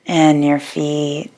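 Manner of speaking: unhurried yoga nidra guidance with long pauses; guided meditation. Real, not acted. Yoga teacher guiding listeners to settle their bodies, calm guided meditation. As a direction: ease them into rest, phrase by phrase, leaving long silences.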